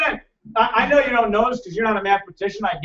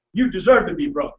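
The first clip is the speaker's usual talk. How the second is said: usual speaking voice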